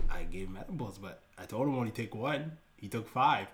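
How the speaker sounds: imitated low-key confession; sheepish admission. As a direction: own up quietly, as the cousin. Cousin sheepishly admitting it.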